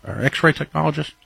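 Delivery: normal talking voice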